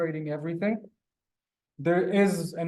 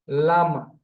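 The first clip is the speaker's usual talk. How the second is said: lama with the first syllable hit hard and louder; exaggerated lama; 4 dB louder than usual. Shown as a demonstration. Teacher exaggerating the word for the class, lama with a punched la. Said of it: "in order to exaggerate".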